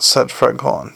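ordinary delivery

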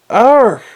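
high, loud-ish cry of discomfort; squirming captain's sudden high outcry; startled yelp. A narrator's reading.

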